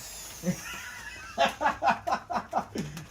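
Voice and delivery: quiet chuckle at his own joke; real soft chuckle; soft laugh. Real, not acted. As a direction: let a small laugh slip out.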